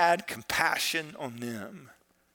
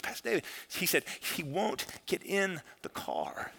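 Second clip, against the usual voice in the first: hushed imitation of a tearful caller; quietly upset, halting as if tearful; retold weeping; 8 dB quieter than usual. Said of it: "she calls and she's weeping".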